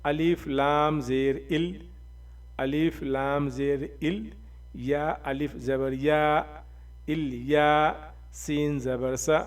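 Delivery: الف لام زیر ال spelled letter by letter, slowly; slow spelling out. Shown as a demonstration. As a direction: dictate each letter and vowel mark slowly for a learner. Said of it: "کیا ہے؟". (حروف اور زیر زبر آہستہ آہستہ ہجے کرتے ہوئے)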